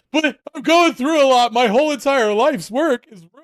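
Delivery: high, loud, whiny mock self-pity; whiny wounded guy. Mocking himself to Greg, a high whiny lament. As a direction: play the hurt friend, high and whiny. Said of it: "and I'm like".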